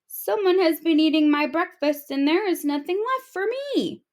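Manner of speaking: put-on high, whiny baby voice; a hint of tears from the baby octopus; baby octopus crying out. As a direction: wail it like a little octopus child. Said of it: "she cried".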